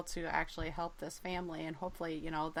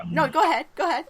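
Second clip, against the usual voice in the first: insistent; 9 semitones higher, 12 dB louder, faster than usual. Real, not acted. Both talked at once, yields.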